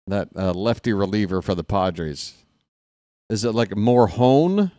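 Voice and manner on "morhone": Morhone said with a breath H for the J; H sound for J. Shown as a demonstration. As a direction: say Morhone like the H in jalapeno. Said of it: "like a H sound to the J".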